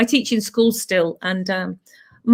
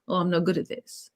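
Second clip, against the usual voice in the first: self-blaming mutter; 4 semitones lower, 9 dB quieter than usual. Retold or imitated. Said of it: "she blames herself".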